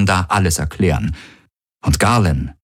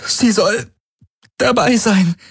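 high, strained voice in labor pain; strained through cramps; slower than usual. A narrator's reading. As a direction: force it out between contractions. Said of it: "presste sie zwischen den Krämpfen hervor".

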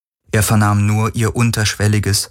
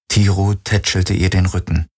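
ordinary delivery

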